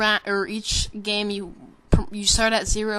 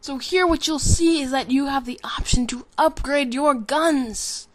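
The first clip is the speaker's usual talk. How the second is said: higher-pitched, punchy web-host delivery; Philip DeFranco; 5 semitones higher, 3 dB louder than usual. Pretend. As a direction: sell it like an online show host. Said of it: "sounded like kinda something like Philip DeFranco".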